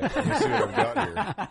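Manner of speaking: high, giggly, amused delivery; chuckling while having a go; laughing. Real, not acted. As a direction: laugh through the words. Amused at attempting to play clip.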